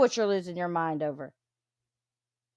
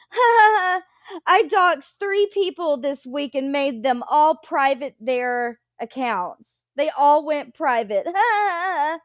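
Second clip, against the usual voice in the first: high cackling mock brag; mocking gloating laugh; 4 semitones higher than usual. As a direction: ham up a smug bragger. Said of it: "she was like".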